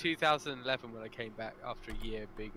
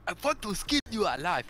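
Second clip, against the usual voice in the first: higher, louder mock South African menace; mock South African accent; 6 semitones higher, 5 dB louder than usual. Joking to friends about South Africans, mock South African accent. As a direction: ham up a South African villain threat. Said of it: "They talk with a machete in South Africa".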